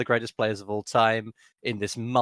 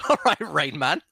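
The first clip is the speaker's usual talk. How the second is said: laughing tease; 3 semitones higher, 3 dB louder than usual. Real, not acted. Teasing, laughing.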